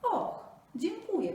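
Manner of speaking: high, loud eager small animal; little jackal. Acted. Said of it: "powiedział szakal".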